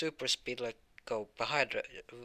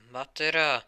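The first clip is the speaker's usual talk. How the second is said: higher, louder cry of defiance; defiant yell; 4 semitones higher, 9 dB louder than usual. Acted. Marshall shouts he is not done yet. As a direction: roar it like a battle oath.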